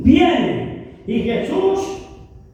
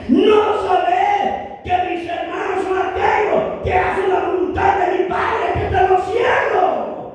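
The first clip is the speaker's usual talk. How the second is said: Jesus proclaiming; 5 semitones higher than usual. Retold or imitated. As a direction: thunder out the words of Jesus. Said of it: "Jesús le contesta".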